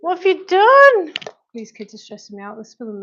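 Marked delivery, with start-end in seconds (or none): 0.1-1.1 exasperated shout. Real, not acted. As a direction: call out in real alarm at the mess. Mother catches kids making a mess.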